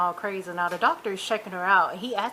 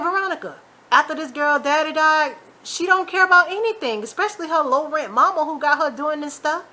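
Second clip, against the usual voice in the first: high, loud, emphatic reproach; indignant talking-to; exasperated; 6 semitones higher, 4 dB louder than usual. Retold or imitated. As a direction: tell her off with raised pitch and force. Exasperated imagined address to Veronica.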